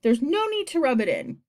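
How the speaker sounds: huffy storybook protest; snapping. A narrator's reading. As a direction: snap it in wounded irritation. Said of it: "snapped the earthworm".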